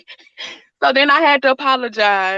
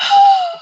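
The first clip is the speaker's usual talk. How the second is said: mock sob; 5 dB louder than usual. Acted sob.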